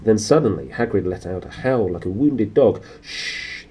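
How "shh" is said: sharp unvoiced shush; a sharp hissed hush. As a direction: hiss it to silence him. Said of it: "hissed Professor McGonagall".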